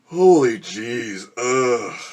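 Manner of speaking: loud, very slow drawn-out groan of burning pain; pained exclamation. Real, not acted. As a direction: stretch it out as the heat hits. Burning mouth from spicy burger.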